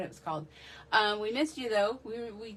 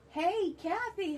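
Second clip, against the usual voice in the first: delighted greeting; 9 semitones higher, slower than usual. Real, not acted. Greeting a returning viewer warmly.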